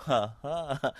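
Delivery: low, soft chuckle; brief quiet laugh to oneself; short hushed laugh. Real, not acted. Teacher chuckles after demonstrating word.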